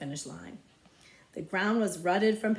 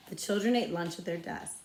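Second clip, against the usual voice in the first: plain reading voice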